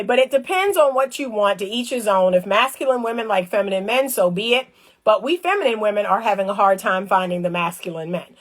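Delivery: normal talking voice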